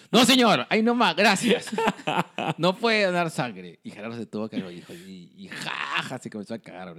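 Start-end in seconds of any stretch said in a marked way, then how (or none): 0.1-1.7 high, loud mock cut-off; emphatic rejection. Blood bank interviewer stops the donor, loud mock refusal. 2.6-3.8 emphatic rejection. Retold or imitated. Acting interviewer rejecting donor.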